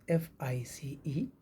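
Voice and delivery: ordinary delivery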